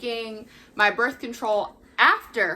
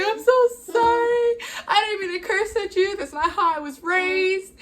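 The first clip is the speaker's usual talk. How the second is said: high, louder, sobbing apology; crying apology; 9 semitones higher, 3 dB louder than usual. Retold or imitated. Apologizing to doctor for cursing, high tearful sobbing. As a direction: sob it out, pitched way up. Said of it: "I break down crying".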